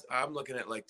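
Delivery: usual speaking voice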